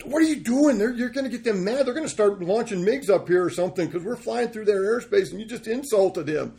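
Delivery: high, loud panicked scolding; alarmed captain. Retold or imitated. Captain scolding his co-pilot in alarm, high and loud.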